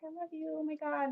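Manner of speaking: gushing girlfriend mock. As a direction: squeal it like a smitten fan. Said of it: "She's like".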